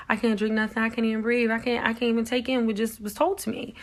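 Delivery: half-relived panic, pushed up and loud; retold panic. Retold panic after cancer news.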